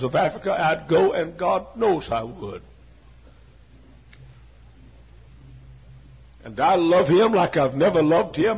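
loud, rising sermon cry; full-force preaching. Acted. Preacher declaring his love for God, preaching full force. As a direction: preach it out to the back pew.

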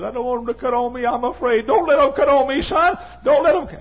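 very high, loud, fast frightened begging; imitating a mother pleading in fear; terrified old mother. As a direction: cry out like a scared old woman begging her son. Mother voiced begging son.

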